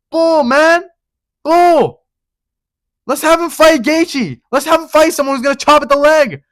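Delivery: real fan crash out; heated rant. Real, not acted. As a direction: blow up at the doubters. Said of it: "That was generational crash out".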